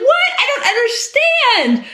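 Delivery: put-on high-pitched confused child voice; baffled kid. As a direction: wail it out like a bewildered kid. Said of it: "These kids are like".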